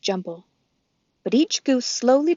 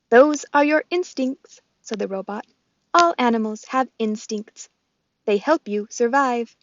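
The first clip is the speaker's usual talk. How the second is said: robot voice; 3 semitones higher, 5 dB louder than usual. Acted. Roz the robot speaks.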